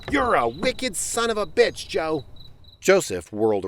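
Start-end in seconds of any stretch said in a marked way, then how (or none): 0.1-2.2 higher, calling insult after him. Acted. Alvin calls after his departing brother, higher and insulting. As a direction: hurl it after him across the yard. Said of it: "Alvin call after".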